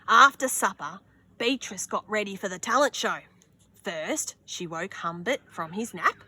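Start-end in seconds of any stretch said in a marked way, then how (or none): none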